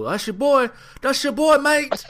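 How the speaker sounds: high-pitched put-on accent, taunting and loud; Grayson Waller accent. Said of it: "I don't know what e-, accent".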